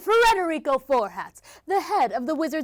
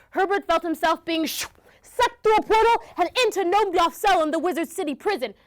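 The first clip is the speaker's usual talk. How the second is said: usual speaking voice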